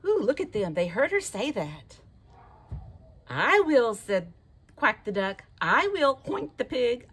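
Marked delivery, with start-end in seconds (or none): 3.3-3.9 excited, loud animal voice; eager volunteer. Acted. 5.6-6.2 loud, eager "I will"; eager volunteer. Acted.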